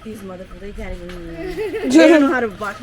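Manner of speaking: a hint of an exasperated dad, loud at the end; imitating dad. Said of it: "Dad's looking down going".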